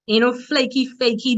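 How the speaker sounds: normal talking voice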